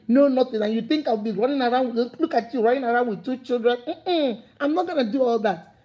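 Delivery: ordinary talk, nothing marked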